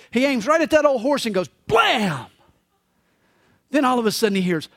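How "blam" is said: mock gunshot. Imitated gunshot.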